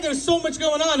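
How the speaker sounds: normal talking voice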